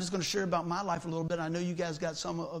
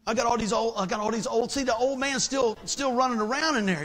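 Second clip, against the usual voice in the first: fired up; 4 semitones higher, 6 dB louder than usual. Real, not acted. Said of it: "excited preaching about old man".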